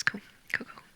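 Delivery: whispered, feigned calm; breathy whisper acting unfazed; playing it cool. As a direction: murmur it like it's no big deal. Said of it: "I was playing it really cool".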